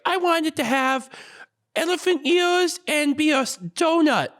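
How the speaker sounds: put-on very high-pitched, squeaky small child; little kid voice. Boy scout describing his dream car, little kid voice. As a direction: do a tiny kid excitedly picking out a design. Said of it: "obviously, as a kid, you're like".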